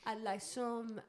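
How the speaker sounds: slow, hammed-up French; mock French accent. Host ordering odd groceries in slow mock French. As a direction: linger on each item in silly French.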